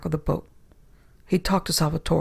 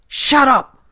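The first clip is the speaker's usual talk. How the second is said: high, loud furious shout; angry shout; 9 semitones higher, 10 dB louder than usual. A narrator's reading. Captain cuts him off.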